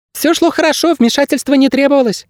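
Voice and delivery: very high, thin creature voice; squeaky bird guide. A narrator's reading. Bird guide justifying his absence, high squeak. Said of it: "пропищал проводник".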